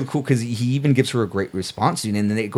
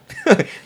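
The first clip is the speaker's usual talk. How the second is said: high laugh; 4 dB louder than usual. Real, not acted. Laughs at Batman never happy.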